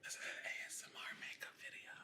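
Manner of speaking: hushed whisper close to the mic; ASMR whisper. Acted. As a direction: whisper like an ASMR video, playful. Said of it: "we will be whispering into the mic".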